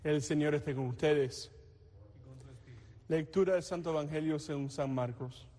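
usual speaking voice

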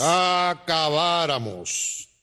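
long, stretched-out syllables; drawn-out realization. Acted. Judge reacting to a tall tale, drawn-out. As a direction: draw the word right out.

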